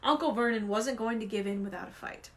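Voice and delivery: ordinary delivery, nothing marked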